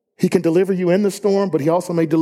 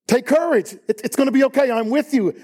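higher, gentle and reassuring; comforting Jesus voice; 7 semitones higher than usual. Shown as a demonstration. Jesus calming terrified disciples, higher and reassuring. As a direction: lift it up to soothe the frightened. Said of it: "I can't think of more comforting words".

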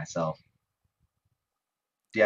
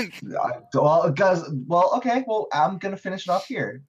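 excited; 10 semitones higher, 4 dB louder than usual. Real, not acted. Excited wrap-up of the rant.

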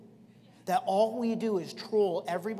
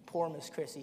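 ordinary talk

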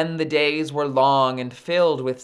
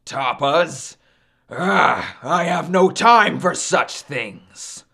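dismissive put-on big voice; scoff, then brush it off more forcefully; scornful sun god; 2 semitones higher, 4 dB louder, slower than usual. Sun dismisses Hina's tapas, scornful.

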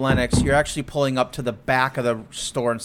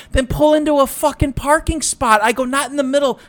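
much higher, louder mock yelling; angry yelling; 7 semitones higher, 3 dB louder than usual. Yelling at the driver to park, high angry shout. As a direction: let him have it, pitch way up. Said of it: "And I go".

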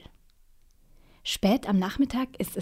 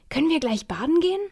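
put-on eager child; 7 semitones higher, 4 dB louder, faster than usual. Child begs to go swimming.

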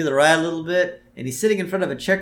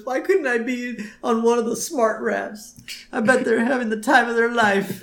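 hammed-up tearful whine; Homer crying; 9 semitones higher than usual. Co-host voicing a restrained castaway envying other rafts, crying. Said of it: "And Homer starts crying".

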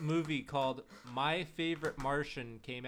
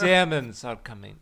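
loud, hammed-up French accent; horrible French accent; 2 semitones lower, 8 dB louder than usual. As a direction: badly fake a French accent. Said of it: "that's a horrible French accent".

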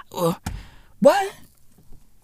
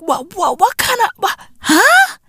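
imitating confusion; 8 semitones higher than usual. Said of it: "I was like".

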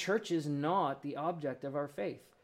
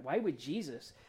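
ordinary talk

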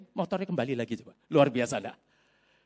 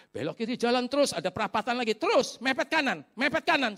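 lightly voiced, higher insistent directions; guiding voice; 8 semitones higher than usual. Preacher relaying the voice's directions to him, higher and urging. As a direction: give directions in a raised, urging voice. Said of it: "Suara itu gitu".

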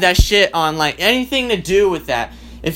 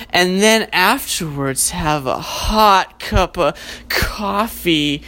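sexy alarm clock voice; slower than usual. Pretend. Flirty alarm clock seducing the sleeper out of bed. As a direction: purr him out of bed slowly. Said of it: "the alarm clock just goes".

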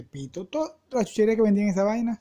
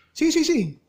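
eager agreement; 8 semitones higher, much slower than usual. Acted.

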